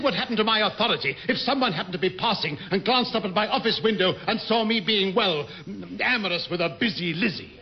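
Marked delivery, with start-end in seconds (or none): none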